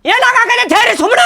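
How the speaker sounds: high-pitched, forceful demand. Acted. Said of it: "ಅವಾಜ್ ಮಾಡಿ ಕೇಳಿದ್ರ".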